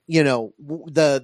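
normal talking voice

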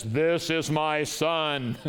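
slow, loud, booming heavenly voice; giant voice of God. Retold or imitated. Preacher voicing God at the baptism, slow booming voice. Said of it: "this giant voice says".

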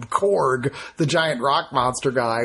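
ordinary talk, nothing marked

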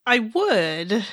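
deliberate, carefully unslurred reply; slow, careful drunk. Acted. Drunk Bo answers Kenzie, slow careful speech. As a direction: place each word with tipsy care. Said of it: "Bo spoke very slowly, making sure not to slur".

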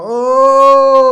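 high, loud mock groan of oh; old lady groaning aloud in the nursing home, high and loud; old lady's loud groan. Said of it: "She'd go".

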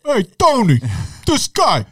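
high, mock gangster voice; hammed-up mobster call-out; old-time gangster. Mobster bit continued.

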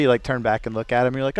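ordinary talk

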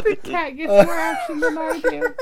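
high mimicked complaint through laughter; laughing mimicry. Co-host mimicking the daughter's line back, laughing. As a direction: echo the quote in a high voice, laughing.

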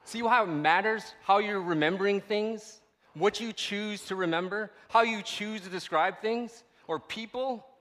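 high, loud, driving sermon voice; loud preacher voice over a mocking crowd quote; preaching at full force. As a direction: preach it out to the whole room. Preaching hard about remembering.

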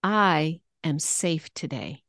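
"I" drawn out and set apart, slow; stress on I. Shown as a demonstration. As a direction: lean slowly on the word "I". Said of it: "how it sounds with word emphasis".

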